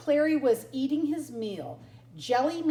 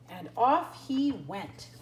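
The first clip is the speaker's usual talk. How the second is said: usual speaking voice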